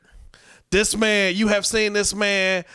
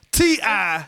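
normal talking voice

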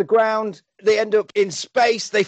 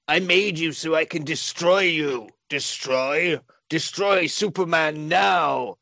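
villain voice; slower than usual. Retold or imitated. Voicing Lex Luthor ordering Nuclear Man.

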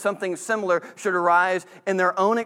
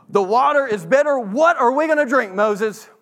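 higher, louder complaining whine; grumbling tone; 7 semitones higher, 7 dB louder than usual. Shown as a demonstration. Thirsty Israelites grumbling to Moses, agitated and higher. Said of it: "That's a very different tone".

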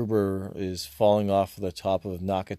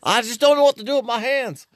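high, loud panicky racer; flustered yelp, hands flailing; Ricky Bobby panicking; 13 semitones higher, 9 dB louder than usual. Retold or imitated. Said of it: "Remember Ricky Bobby".

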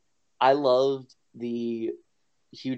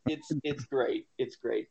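ordinary delivery

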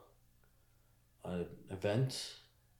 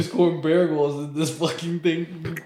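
hyped; 7 semitones higher, 11 dB louder than usual. Retold or imitated. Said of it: "retelling his bet hype".

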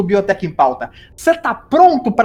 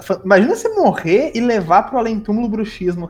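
ordinary talk, nothing marked

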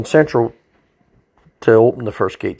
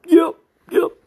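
much higher, pinched yep yep; muttered prisoner voice. Retold or imitated. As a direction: mumble it without moving your head.

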